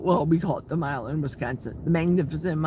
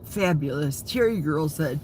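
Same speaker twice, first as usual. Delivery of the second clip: ordinary delivery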